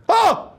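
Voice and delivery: sharp high wordless cry; startled yell. Retold or imitated. Dudley seeing the shooting, crying a high ah. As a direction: cry out ah in shock. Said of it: "like that".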